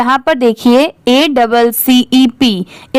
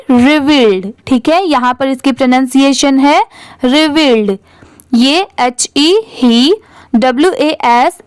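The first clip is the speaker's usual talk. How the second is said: ordinary talk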